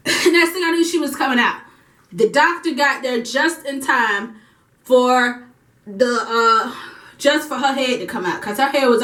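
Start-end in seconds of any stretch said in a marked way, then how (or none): none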